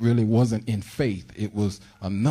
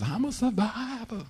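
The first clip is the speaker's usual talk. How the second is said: mock minister proclaiming; 14 semitones higher, 4 dB quieter than usual. Mimicking other ministers.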